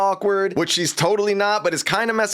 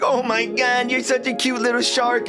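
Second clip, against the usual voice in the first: lightly voiced cooing teen girl, higher; gushing girl voice; 5 semitones higher than usual. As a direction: coo at the little shark, a bit higher than your own voice. Penny gushing over Jeff.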